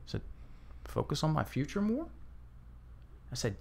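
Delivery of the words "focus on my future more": "Focus on my future more?" with a rising, doubtful end; said like a question. Retold or imitated. Retelling the client's hesitant answer, future more lifting like a question. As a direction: make "more?" rise as if unsure. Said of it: "he said it like a question".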